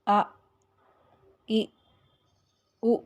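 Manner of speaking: short vowels each stopped abruptly in the throat; آ ای او each clipped off with a glottal stop; vowels cut off sharply. Shown as a demonstration. Teacher demonstrating vowels stopped with a jolt. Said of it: "جیسے".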